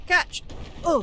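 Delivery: high, loud, sudden yelps; urgent cry. Real, not acted. Player urging a catch mid-jump, urgent cry. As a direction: yelp it out in the heat of play.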